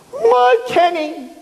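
high, drawn-out wail; screaming. Retold or imitated. Mother lifting the sheet off her son, screaming. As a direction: wail high over your child. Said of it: "picks it up, and starts screaming".

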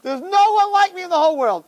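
put-on high, ringing drunken boast; boastful lion. Drunk who became a lion boasting of himself, high-pitched swagger. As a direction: proclaim it way up high, full of swagger. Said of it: "so you become a lion".

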